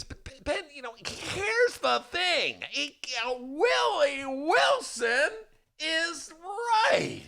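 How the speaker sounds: very high, slow, shouting voice; Kenny Davis impression. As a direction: drag it out high, then shout. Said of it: "now I'm doing my Kenny Davis".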